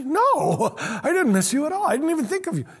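high, light mock reply, a laugh in it; mock spouse, curious. Spouse teasing after a trip, high and light.

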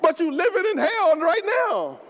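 fiery preaching. Acted. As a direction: drive the point home to the church. Preaching at full force.

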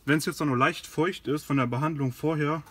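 ordinary talk, nothing marked

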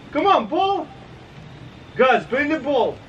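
high, swooping pet voice; high dog-calling. Real, not acted. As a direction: coax the dog to fetch in a high voice. Urging dog to fetch.

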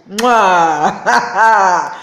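loud, drawn-out "mwah-ha-ha" cackle; angry laugh. Real, not acted. Angry woman taunts the government online, loud mocking cackle. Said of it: "I'm laughing because I'm angry".

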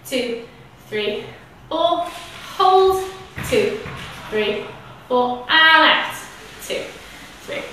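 slow guided breath count. Shown as a demonstration. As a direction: count the breaths calmly and slowly. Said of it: "So we'll do it together".

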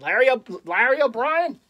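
badly faked Boston accent, high and loud; horrible Boston accent. Collector trying a trophy's name, failed Boston accent. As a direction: botch a Boston accent, unsure of it. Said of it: "That was horrible".

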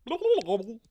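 loud, high gobbling; turkey gobble. Acted. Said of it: "said the turkey".

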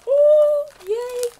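loud, high squeal of delight; excited. Real, not acted. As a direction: squeal happily at a gift. Delighted at opening a gift.

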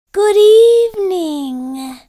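high, slow, silky voice; high silky monster. Acted. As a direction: purr it high and smooth. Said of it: "he said in a high, silky voice".

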